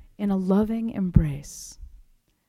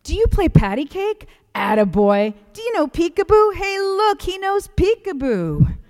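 put-on high, loud sing-song call to a baby; loud, high baby-talk call; calling across the room; 11 semitones higher, 5 dB louder than usual. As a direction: drunk old man hollering across a restaurant. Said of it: "calling across the dining room".

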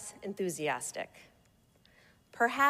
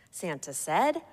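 ordinary delivery

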